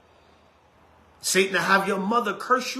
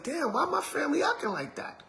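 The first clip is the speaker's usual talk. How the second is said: higher, softer hurt complaint; emotional believer; 7 semitones higher, 8 dB quieter than usual. Retold or imitated. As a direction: let it out softly, higher and stung. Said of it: "now you're emotional and like".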